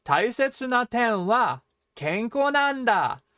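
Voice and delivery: loud, high "kenko nanda" after a short pause; emphasis on kenko nanda. Shown as a demonstration. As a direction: set off and punch "kenko nanda". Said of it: "You're emphasizing kenko".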